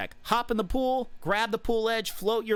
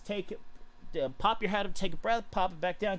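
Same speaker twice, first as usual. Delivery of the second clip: normal talking voice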